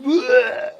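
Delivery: high, comic vomiting noise; imitated retching. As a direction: mimic someone throwing up violently. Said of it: "he's just throwing up violently".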